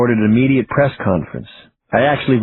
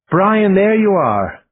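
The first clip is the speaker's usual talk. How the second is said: put-on slow, lilting Irish accent; 8 semitones higher, slower than usual. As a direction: greet him warmly in an Irish accent. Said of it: "she said in her funny Irish accent".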